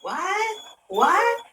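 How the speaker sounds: high, startled question; rising, incredulous mock reaction; retold shock. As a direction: act out baffled disbelief. Reacting to screaming on call.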